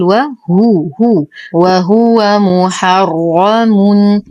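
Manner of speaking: وهو recited very slowly with a full round ُ on the ه; slow recitation. Shown as a demonstration. Teacher demonstrates the full vowel on the ه, very slow recitation. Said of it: "ننتبه كثيرًا لإتمام ضمة الهاء".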